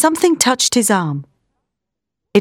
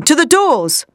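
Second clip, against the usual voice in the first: higher-pitched urgent command; urgent cry; 7 semitones higher than usual. A narrator's reading. Professor sends the men fleeing, urgent cry. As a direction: call the order out, pitch lifted. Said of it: "cried Van Helsing".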